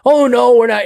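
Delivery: cop's loud quick denial; a blurted cop denial. Retold or imitated. Cop voiced in retold story.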